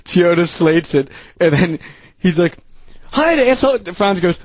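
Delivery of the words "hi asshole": high-pitched mock hello; put-on high voice; imitated greeting. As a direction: chirp the insult in a raised voice. Acting out greeting from story.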